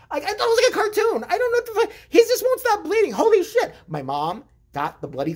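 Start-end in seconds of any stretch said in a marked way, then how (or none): 0.1-3.7 high excited disbelief. Real, not acted. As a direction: pitch shot up, laughing in disbelief. Excited retelling of bleeding.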